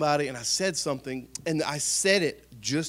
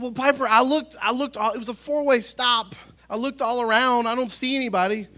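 much higher, louder flustered excuse-making; defensive excuses; 10 semitones higher, 8 dB louder than usual. Retold or imitated. Dad justifying the stop sign to his daughter, high flustered excuses. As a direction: talk your way out of it, flustered. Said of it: "And I said".

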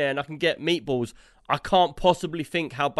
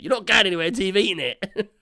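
strict nan; 6 semitones higher, 5 dB louder than usual. Retold or imitated. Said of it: "She's making me eat it".

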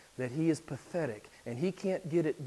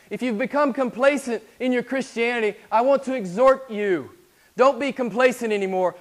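loud, high, urgent exhortation; rally them to chase after him; full-force preaching; 9 semitones higher, 11 dB louder than usual. Acted. Preacher exhorting complacent Christians, loud and high.

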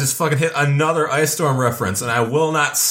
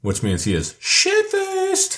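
much higher, very slow silly delivery; goofy slow voice for a drunk uncle; mock drunk voice; much slower than usual. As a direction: stretch it out for the joke. Joking about the drunk uncle.

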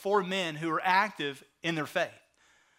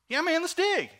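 much higher, excited reply; acted-out enthusiastic buddy; eager agreement; 12 semitones higher than usual. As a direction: jump on the idea with a high-pitched yes. Acting out friends deciding to dig.

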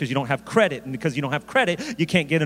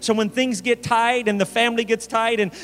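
higher, driving preacher delivery; impassioned preaching; 6 semitones higher than usual. Real, not acted. Preacher urging the congregation to sow seed, fervent preaching. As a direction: press the point home with rising fervour.

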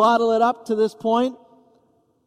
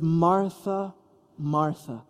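soft, tender, falling away; gently calling a loved one's name; Jesus gently addressing the upset Martha, tender; compassionate; 3 semitones lower, 4 dB quieter, much slower than usual. Acted. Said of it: "speaks to Martha as one who is full of compassion".

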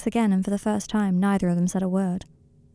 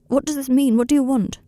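higher, louder, pressing questions; anxious demand; 6 semitones higher, 5 dB louder than usual. A narrator's reading. Draco presses Harry to explain, urgently.

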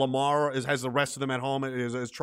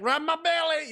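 high-pitched begging dog voice; submissive dog; 11 semitones higher than usual. Acted. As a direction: plead like a dog rolled on its back. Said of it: "you're like a dog".

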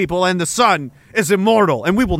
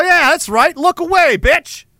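cocky taunt; 8 semitones higher than usual. Pretend. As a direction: gloat at the blinded beachgoers. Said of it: "I go".

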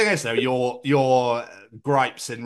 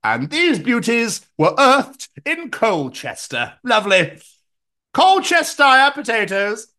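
higher, slow, hammed-up produce presenter; mock produce advert; 8 semitones higher, 4 dB louder, slower than usual. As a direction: show off the harvest, louder and drawn out.

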